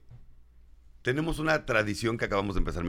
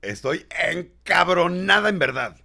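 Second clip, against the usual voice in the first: reading angry message; 6 semitones higher, 5 dB louder, slower than usual. Retold or imitated. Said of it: "¿Qué dice?".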